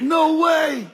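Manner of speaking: high loud no way; shocked shout. Retold or imitated. Preacher retelling his reply to Miss Hogan, loud shocked shout. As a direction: shout it in disbelief. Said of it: "I said".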